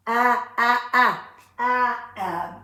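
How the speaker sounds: short A in "a" slow and loud; sounding out. Shown as a demonstration. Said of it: "So that's a short A".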